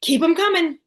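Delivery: eager. Retold or imitated. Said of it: "funeral home director is like".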